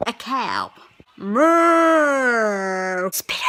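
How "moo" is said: loud drawn-out mooing cow; big cartoon cow moo. Shown as a demonstration. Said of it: "I'd do some animal impressions".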